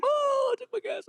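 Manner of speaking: high mock crowd cry; stadium crowd. Imitating the stadium crowd, high and hammed-up. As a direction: ham up the crowd's reaction. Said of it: "the whole stadium".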